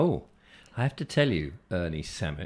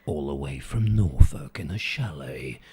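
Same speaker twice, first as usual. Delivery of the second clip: low, husky, portentous trailer voice; deep, slow dramatic voiceover; deep-voiced film trailer man; 7 semitones lower than usual. Shown as a demonstration. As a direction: announce small news like an epic film. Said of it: "make the ordinary sound extraordinary".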